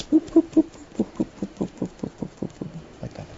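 high popping boops fading to a breathy whisper; imitated popping noises. Shown as a demonstration. Teacher to students, showing the mind labelling things, with popping boops. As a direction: pop out the boops, then let them die away. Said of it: "just like this".

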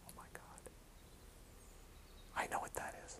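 breathless, hushed gasp of recognition; awed whisper. Retold or imitated. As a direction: whisper it in sudden awe. Family member's sudden recognition.

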